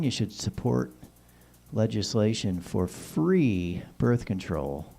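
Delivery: usual speaking voice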